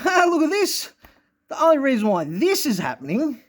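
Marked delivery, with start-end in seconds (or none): 0.0-0.9 much higher, lightly put-on voice; playful high-pitched aside; high mock exclamation. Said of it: "And I go".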